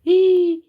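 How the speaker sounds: very high, loud yelp; frightened squeal. Real, not acted. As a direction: squeal as if just spotted. Spots someone hunting them. (petit cri aigu de frayeur)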